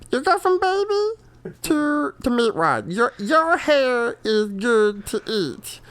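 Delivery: high, slow cartoon voice; Meatwad voice. Shown as a demonstration. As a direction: babyish cartoon drawl. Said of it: "drifts into meatwad".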